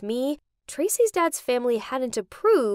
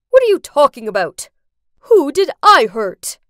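loud, fast angry demand; defensive shout; 11 dB louder than usual. Acted. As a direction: fire it back at his daughter. Father accused at wedding.

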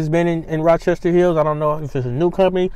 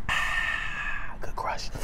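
breathy whisper after a sip; 13 dB quieter, much slower than usual. Real, not acted. After a sip of soda, whispered relish. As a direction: savor the drink in a whisper.

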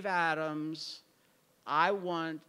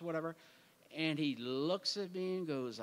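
ordinary talk, nothing marked

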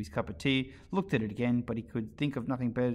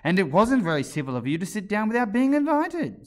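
indignant retort; 9 semitones higher, 8 dB louder than usual. A narrator's reading. March Hare retorting to Alice.

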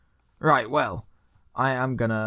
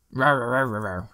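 mock angry growl. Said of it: "that was anger".

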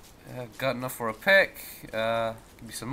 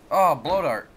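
ordinary talk

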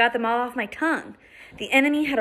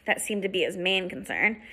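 usual speaking voice

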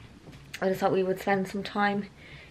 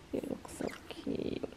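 slow, hushed whisper to a pet; cooing whisper; 18 dB quieter, slower than usual. Real, not acted. Owner admiring the pug in a little towel, whispered coo. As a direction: whisper it slowly, adoringly.

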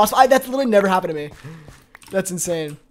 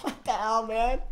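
breathy laugh; 13 dB quieter, slower than usual. Real, not acted. Laughing in disbelief.

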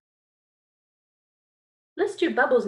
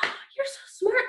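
delighted praise; 12 semitones higher, slower than usual. Acted. Teacher praises pupils, gasp.

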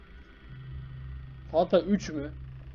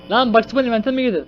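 high, loud, alarmed question; startled shout; 8 semitones higher, 9 dB louder than usual. Real, not acted. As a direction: call out in sudden disbelief. Startled player questions game character.

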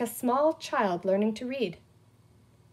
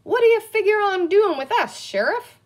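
loud, high-pitched put-on cowboy talk; cowboy lingo; 11 semitones higher, 8 dB louder than usual. A narrator's reading. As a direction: call it out in Western lingo. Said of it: "falling quickly into the lingo".

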